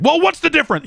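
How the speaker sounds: squeaky high mock senator, loud; mocking clueless lawmakers in a shrill-pitched squeal; mock Congress voice. Mocking Congress brushing off Nintendo's Japan defense, high mock whine.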